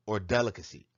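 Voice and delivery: ordinary talk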